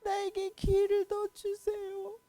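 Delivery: high, loud, sobbing plea; king sobbing a prayer to God; crying prayer. Acted. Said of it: "막 울었어요".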